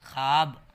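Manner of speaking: ख्वाब as "khaab", one long syllable; correct silent-wao reading. Shown as a demonstration. Teacher showing how the written word reads, ख्वाब said "khaab". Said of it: "वाव के साथ पढ़ते हैं अलिफ की तरह".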